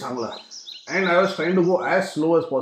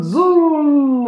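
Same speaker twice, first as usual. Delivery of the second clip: zoom sound effect; 10 semitones higher, 7 dB louder than usual. Pretend. Bike racing downhill.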